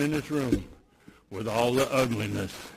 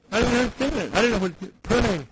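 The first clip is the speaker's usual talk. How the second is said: usual speaking voice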